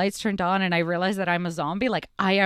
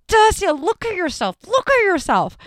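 much higher insistent plea; pressing high call; urgent coaxing; 11 semitones higher, 4 dB louder than usual. Retold or imitated. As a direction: friend urging her to look. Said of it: "Kaylin's like".

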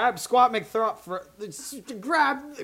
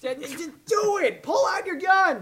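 shouting; 8 semitones higher, slower than usual. Pretend. Captain orders crew to shoot.

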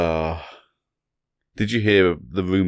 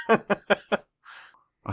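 laughing; 12 semitones higher, 4 dB quieter than usual. Real, not acted. Joke through giggles.

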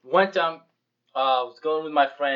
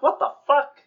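high, loud incredulous outburst; what the hell, are you kidding; shocked disbelief; 10 semitones higher, 6 dB louder than usual. Retold or imitated. Retold disbelief at friend.

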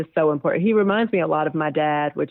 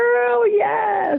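much higher and louder exclamation; emphatic yes; 4 dB louder than usual. Real, not acted. Guest confirming her fertility struggles to the host, loud high burst. Reacting to fertility issues mention.

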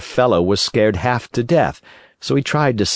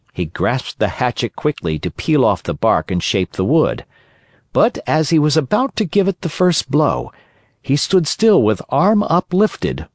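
ordinary delivery

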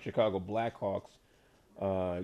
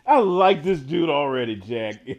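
louder and higher, still giggling; laughing excitement; 3 semitones higher, 10 dB louder than usual. Real, not acted. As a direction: gush about the new guy. Host thrilled by the answer.

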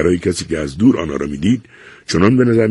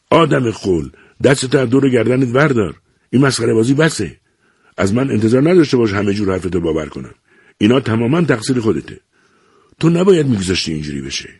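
put-on higher, heated old father scolding; father loses patience with his son; angry scolding; 6 semitones higher than usual. A narrator's reading. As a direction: tell the fool off.

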